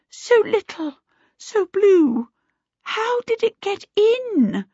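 very high, shaken voice; gasping fright. A narrator's reading. Missus Baker stunned by the little blue kobold, gasping. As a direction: marvel in horror, high-pitched. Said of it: "gasped, holding her chest".

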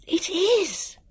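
high gasping confirmation; high, excited agreement without shouting; astonished realisation. A narrator's reading. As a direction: say it high, amazed it is true.

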